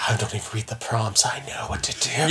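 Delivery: hushed eager whisper; excited whisper. Retold or imitated. Gamer retelling how he played, in a hushed whisper. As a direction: breathe it like a secret thrill.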